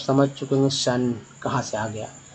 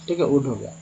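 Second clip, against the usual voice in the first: normal talking voice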